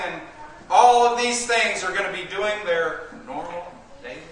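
loud fiery preacher's voice; preaching. Acted. Preaching at full force.

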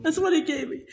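usual speaking voice

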